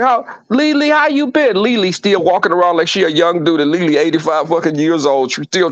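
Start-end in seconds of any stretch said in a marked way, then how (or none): 0.0-1.6 put-on higher-pitched greeting to an old acquaintance; mock greeting. Teller hailing old Lily, higher mock greeting. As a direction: call out a high, lively hello. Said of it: "and you be like".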